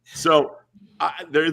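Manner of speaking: ordinary delivery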